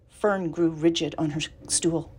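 usual speaking voice

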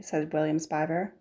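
plain reading voice

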